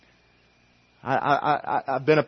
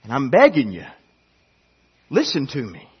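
God insisting; 3 semitones higher, 4 dB louder than usual. Acted. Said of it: "God's saying".

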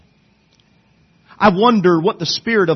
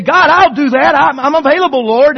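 1 eager volunteering; 9 semitones higher, 6 dB louder than usual. Acted. Eager volunteer answers God's call.

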